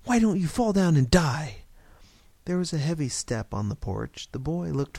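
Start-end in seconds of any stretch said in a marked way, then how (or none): 0.0-1.6 put-on loud, high child's taunt; insistent, raised kid's shout; boy crying out. A narrator's reading. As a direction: cry it out like a boy playing gunfighter. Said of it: "he cried".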